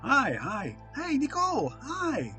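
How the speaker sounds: ordinary delivery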